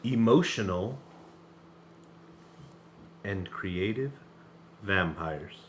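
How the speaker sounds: slow, mellow radio host read; smooth late-night DJ delivery. Shown as a demonstration. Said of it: "That's my mellowed out radio voice".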